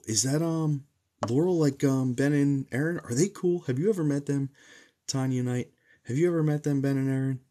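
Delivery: loud, high, eager questions; excited questioning. Real, not acted. Streamer asking a viewer about Ben and Aaron, excited questioning. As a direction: call out eagerly, pitched well up.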